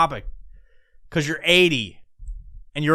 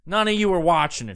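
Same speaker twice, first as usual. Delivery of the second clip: ordinary talk, nothing marked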